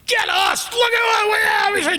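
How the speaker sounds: high, shouted triumph; triumphant mock shout. Young improvisers gloating over catching a star, high shout. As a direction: crow over the captured prize. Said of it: "and we thought".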